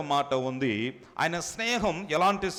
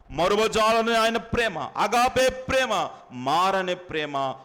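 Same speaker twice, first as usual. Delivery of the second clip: higher and louder, lifting each phrase; fervent preaching; 5 semitones higher, 5 dB louder, slower than usual. Real, not acted. Preacher exalts God's love to the congregation, fervently. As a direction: proclaim it with rising fervour.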